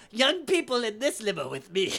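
high, loud, grand herding call; flamboyant host. Retold or imitated. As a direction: summon them showily, in an imitated old man's cry. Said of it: "so extravagant and flamboyant".

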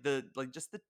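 ordinary delivery

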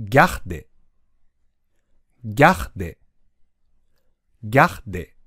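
low, measured ギャで with a French r breathed before で; two syllables joined. Shown as a demonstration. Teacher drilling only the end of regarder, joined up and repeated. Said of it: "ギャでだけ練習してみましょ".

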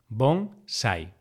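bonsai split into bon, sai; syllable by syllable. Shown as a demonstration. Teacher dividing bonsai for learners, syllable by syllable. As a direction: say "bonsai" with a pause between syllables.

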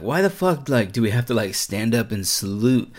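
mock indignation. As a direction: protest it like a retold rant. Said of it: "he was like".

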